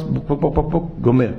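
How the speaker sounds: quick imitated glugging from the bottle; mock gulping noises. The son sneaking wine, playful glugging sounds.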